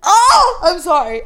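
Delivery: laughing hard, high and loud; cracking up mid-sentence. Real, not acted. Laughing fit while telling story.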